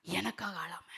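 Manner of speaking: a soft, breathless whisper. Acted. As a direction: drop to a whisper, as if confiding. Sudden hushed aside after pause.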